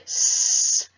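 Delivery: loud, long hissed S; drawn-out s like a snake. Shown as a demonstration. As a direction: hiss it out like a snake. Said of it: "it sounds like a snake".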